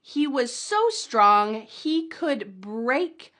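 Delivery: slow, emphatic teaching read; slow model sentence. Shown as a demonstration. Teacher reading the strength sentence slowly and clearly. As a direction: stretch it out so learners can copy. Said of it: "in a challenge sentence".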